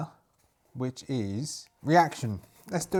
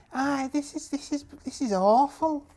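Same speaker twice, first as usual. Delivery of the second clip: very high put-on voice; high-pitched character voice, appalled; disgusted crewman; 11 semitones higher than usual. Bates reacts to eyeball necklace.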